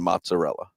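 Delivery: "mozzarella" as "MOT-sa-rella", no N; correct mozzarella. Shown as a demonstration. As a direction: say "mozzarella" with a clean TS. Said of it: "there's no N in".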